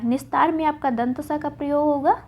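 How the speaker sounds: normal talking voice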